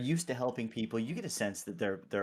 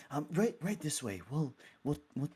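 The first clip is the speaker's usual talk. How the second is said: slightly higher, halting cleric; hesitant clergy guide; 4 semitones higher than usual. Acted. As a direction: stumble politely while showing the way. Clergy member leads the group.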